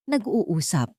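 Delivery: ordinary delivery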